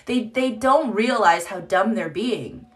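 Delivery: normal talking voice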